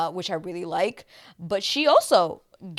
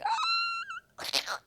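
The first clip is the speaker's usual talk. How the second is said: gasp; 6 dB quieter than usual. Retold or imitated. Recalling the dramatic moment.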